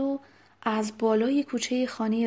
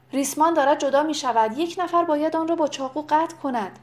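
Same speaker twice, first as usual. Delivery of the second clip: raised, high cry of warning; urgent shout; 5 semitones higher, 4 dB louder, faster than usual. A narrator's reading. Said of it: "یکی از مردان فریاد زد". (فریاد هشدار با صدای زیر)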